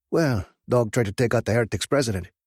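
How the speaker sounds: a hint of an urgent friend; biker friend voice. Track reporting news.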